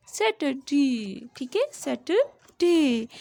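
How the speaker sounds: सैटरडे spoken very slowly, ending on a light ड; teacher models सैटरडे slowly; slow careful pronunciation. Shown as a demonstration. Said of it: "डे की जो sound है ड आएगी".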